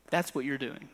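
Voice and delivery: ordinary delivery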